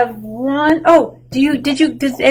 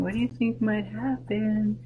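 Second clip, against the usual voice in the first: ordinary talk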